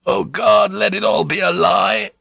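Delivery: high, breaking cry of despair; anguished outburst. A narrator's reading. Blackthorn bursts out in grief over his burned ship. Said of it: "he burst out".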